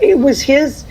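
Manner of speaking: usual speaking voice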